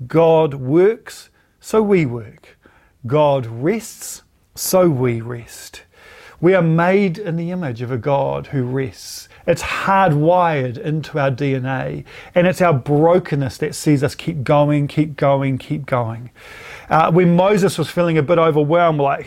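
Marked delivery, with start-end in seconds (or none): 3.1-5.8 slow, lower, settling into rest. Shown as a demonstration. God rests, so we rest. 15.6-16.3 softer, lower, fading to nothing; trailing off softly. Shown as a demonstration. Repeating keep going, fading out.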